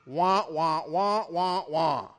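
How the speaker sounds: muffled trombone wah-wah voice; Charlie Brown teacher. Retold or imitated. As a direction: mimic the garbled adult voice. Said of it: "sounds like the teacher in Charlie Brown".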